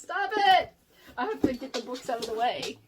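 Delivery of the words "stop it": higher, louder cry to a pet; sharp scolding. Real, not acted. Owner telling dog not to eat books, high loud scolding. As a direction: call the dog off, pitched up sharply.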